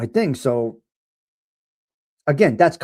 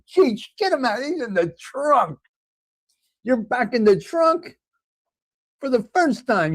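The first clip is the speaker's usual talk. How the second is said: higher, hammed-up gangster voice welcoming Stony to the trunk; mock mobster boss; 8 semitones higher than usual. Host putting chatter Stony in the trunk, hammed-up mobster. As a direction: play a gleeful mob boss dishing out punishment. Mob boss ordering henchman.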